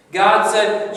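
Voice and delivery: usual speaking voice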